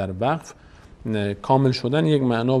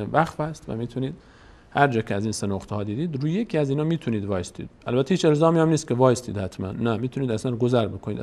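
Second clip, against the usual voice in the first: ordinary delivery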